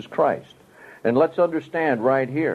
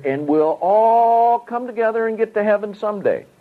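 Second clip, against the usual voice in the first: mocking; 8 semitones higher, 3 dB louder than usual. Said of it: "Like a lot say".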